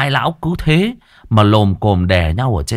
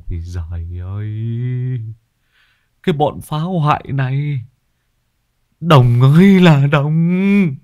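despairing lament; 4 semitones higher than usual. Acted. Bald man laments wrecked yard, despairing wail. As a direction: moan over the wreck, then wail the name.

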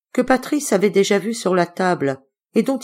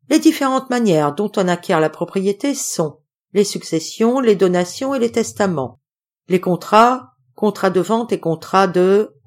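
singsong recited answer; a student reciting his law lesson to his patron; recitation; 4 semitones higher than usual. Acted. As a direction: recite it like a lesson learned by heart. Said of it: "sur le ton récitatif".

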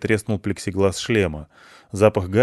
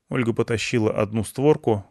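ordinary delivery, nothing marked

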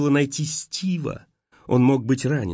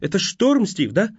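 shouting; 10 semitones higher, slower than usual. A narrator's reading. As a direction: yell over the storm to make him answer. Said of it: "кричала я".

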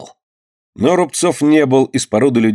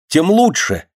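high, glad burst; a happy exclaim, pitched up high; joyful exclamation; 8 semitones higher than usual. A narrator's reading. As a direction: cry it out with delight. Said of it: "воскликнул Рубцов радостно".

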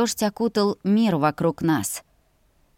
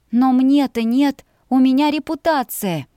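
indignant protest; 5 semitones higher, 5 dB louder than usual. A narrator's reading. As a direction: protest with offended insistence. Heroine protests about her reputation.

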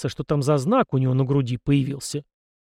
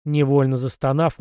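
ordinary delivery, nothing marked

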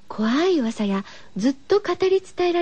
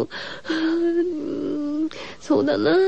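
mock sob; much slower than usual. Host weeps over the sad story.

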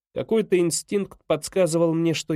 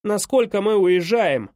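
louder, higher-pitched shouted question; shouting; 5 semitones higher, 5 dB louder than usual. A narrator's reading. Boy calls to father from his room, shouting. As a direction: call it out urgently, pitch raised. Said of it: "крикнул я".

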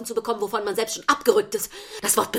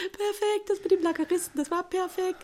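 laughing; 9 semitones higher than usual. Acted. Lacht, lobt Ehemann.

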